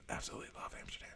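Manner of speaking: breathy whispered aside. Real, not acted. Whispering his love for Amsterdam to the stream. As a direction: hush it like a secret.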